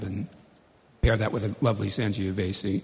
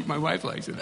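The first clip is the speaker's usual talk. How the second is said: laughing; 8 semitones higher, slower than usual. Real, not acted. Laughing through own joke.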